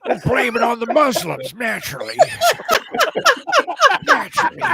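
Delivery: villain voice. Acted. Mock villain plan line.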